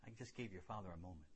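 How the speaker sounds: low, hushed, measured words with long pauses; God speaking gently. Retold or imitated. God to a son who just forgave his father, quiet and low. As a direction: softly give God's answer, letting each sentence settle.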